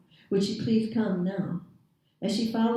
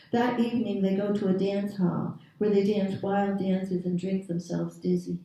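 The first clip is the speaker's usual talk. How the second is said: ordinary delivery, nothing marked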